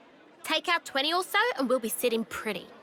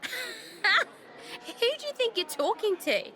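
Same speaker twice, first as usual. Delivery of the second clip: laughing; 7 semitones higher, much slower than usual. Acted. Teased, he laughs it off.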